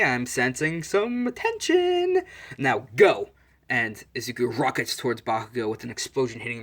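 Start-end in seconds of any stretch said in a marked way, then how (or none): 0.0-2.2 slow, very high teasing lilt; teasing singsong. Retold or imitated. Referee Midnight to the two fighters, teasing singsong. 2.6-3.3 loud, sudden command; starting call. Retold or imitated. Referee Midnight launching the match, loud call.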